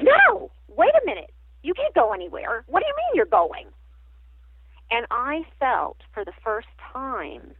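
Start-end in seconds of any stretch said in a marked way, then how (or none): 0.0-3.7 high, pleading objection; dismayed protest with swooping high pitch; retold alarmed protest. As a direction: re-enact a startled plea, pitch leaping up. Retelling her plea as friend leaves.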